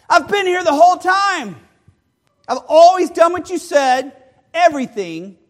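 high, loud aggrieved protest; bitter complaint. Acted. Older son to his father, loud bitter protest. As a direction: throw the years of service in his face.

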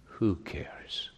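unvoiced whisper, tossing it off; whispered shrug. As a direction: breathe it like a careless aside. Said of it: "mock reply of a careless listener".

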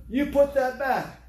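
slow loud retold scolding; stern command. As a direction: bark it like a store clerk catching a thief. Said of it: "some lady came over there".

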